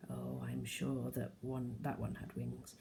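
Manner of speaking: soft, slow, tender near-whisper; gently, as if the baby is asleep. A narrator's reading. Mum tender about the baby.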